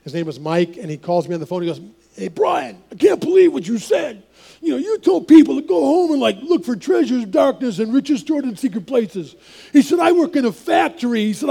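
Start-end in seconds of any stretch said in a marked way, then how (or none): 2.2-9.3 put-on New York accent. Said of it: "a bit of an accent". 10.0-11.2 put-on New York accent. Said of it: "a bit of an accent".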